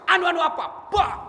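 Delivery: high, loud angry demand. Acted. Angel demands an answer from the stammering master, loud and angry. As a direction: pounce on his stammer.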